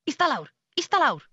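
high, quick repeated command; dog command. Shown as a demonstration. Welsh singer showing how she talks to her dog, high commanding voice. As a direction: call it out like an order to a dog. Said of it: "speak English to my dog".

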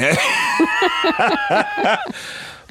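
a long, high burst of real laughter. Real, not acted. Laughing at the strip club memory.

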